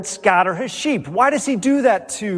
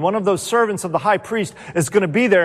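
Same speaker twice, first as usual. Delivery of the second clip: ordinary talk, nothing marked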